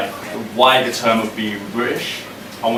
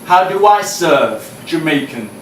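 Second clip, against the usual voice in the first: raised, ringing performance poem voice; higher and louder performance reading; spoken word poem; 6 semitones higher, 3 dB louder, slower than usual. Acted. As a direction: deliver each line to the room like a slam poem.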